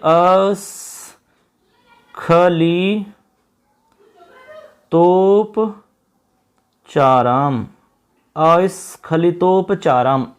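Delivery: very slow, writing as he speaks; slow dictation. Shown as a demonstration. Teacher writes the word on screen, dictating slowly. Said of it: "ऐसे आपको इसमें विच्छेद करना होगा".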